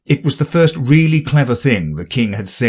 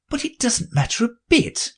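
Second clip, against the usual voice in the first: thinking to herself; 14 semitones higher, 5 dB quieter than usual. A narrator's reading. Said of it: "she thought to herself".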